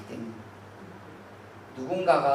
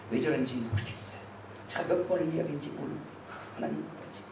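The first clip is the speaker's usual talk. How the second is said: whispered, fast tattling to God; whispered prayer; 4 semitones lower, 10 dB quieter than usual. Shown as a demonstration. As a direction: hush it like a secret complaint. Inner prayer continues. (속삭이며 하나님께 일러바치기)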